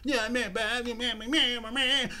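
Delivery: mocking young gamer slang, lazy and mumbled; imitating kids. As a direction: do a dismissive teen on a headset. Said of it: "That's how they talk".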